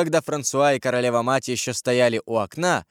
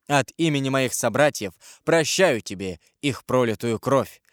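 ordinary delivery

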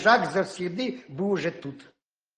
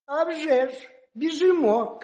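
higher, casual agreeing; hedgehog answering; 9 semitones higher than usual. Acted. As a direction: shrug it off, sure. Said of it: "каже їжак".

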